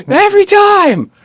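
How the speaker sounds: high, slow, loud comic wail; hammed-up high shout of frustration; mock exasperated yell. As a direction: yell it drawn out in mock despair. Acts out exasperation at repeated ad.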